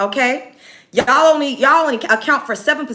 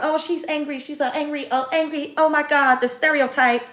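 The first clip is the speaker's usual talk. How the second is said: mock-concerned tattling voice, pitched higher; 6 semitones higher than usual. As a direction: sneer at them as a whiny accuser. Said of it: "y'all say".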